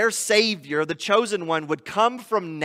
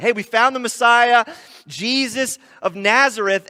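excited announcement; 5 semitones higher, 7 dB louder than usual. Acted. Excited disciple announcing Messiah.

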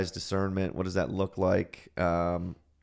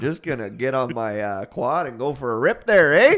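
mock Albertan accent; 3 semitones higher, 7 dB louder than usual. Joking about the Albertan accent, lightly mocking Canadian twang. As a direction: tease the local way of talking. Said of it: "making fun of it".

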